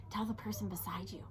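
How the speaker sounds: fast whispered aside; quick hushed prompt. Real, not acted. Whispered instruction.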